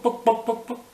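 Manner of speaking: high, loud clucked "Book, book!"; chicken cluck. Acted. Chicken clucking at the librarian, high and loud. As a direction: squawk like a hen.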